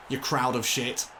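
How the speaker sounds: normal talking voice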